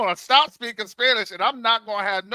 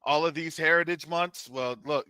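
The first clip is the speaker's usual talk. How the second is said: normal talking voice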